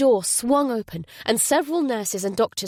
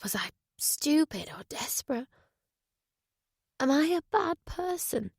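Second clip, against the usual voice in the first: hushed self-doubt; 6 semitones higher, 5 dB quieter than usual. Acted. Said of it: "she wondered".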